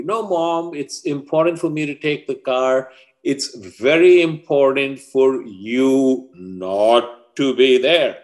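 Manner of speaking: high, emphatic teenage protest, acted; insistent son. Son wanting Mom to stay away, acted high-pitched insistence.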